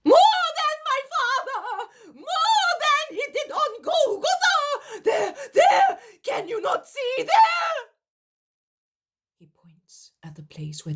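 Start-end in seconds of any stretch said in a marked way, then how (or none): 0.0-7.9 very high, loud wailing cries; frenzied shrieking. Acted. Simon raving at the onlookers, very high wailing shout. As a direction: rave and point in terror. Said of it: "he shouts and shouts and shouts".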